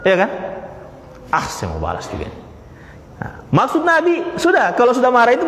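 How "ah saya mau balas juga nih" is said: mock angry mutter. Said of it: "Kan udah marah itu".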